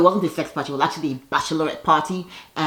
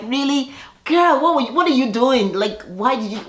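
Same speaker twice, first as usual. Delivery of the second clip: put-on higher, incredulous girl what; mock disbelief; 6 semitones higher than usual. Candy questioning Tanya, mock disbelief. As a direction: act stunned. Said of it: "Candy is like".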